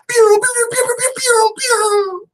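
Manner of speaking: very high, loud squealing air horn pews; air horn imitation. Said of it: "I ain't got my air horns 'cause you know I'm pew".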